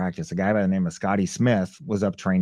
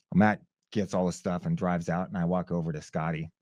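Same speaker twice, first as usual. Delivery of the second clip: normal talking voice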